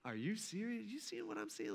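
small, high, very soft voice; stunned bystander checking quietly with a neighbour; hushed disbelief. Acted. Stunned crowd murmuring to each other.

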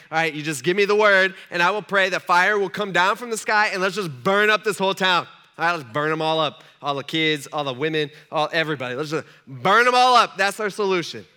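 loud, hammed-up call for destruction; rally them loudly, like an overeager zealot; zealous disciple. Acts disciples demanding fire on town.

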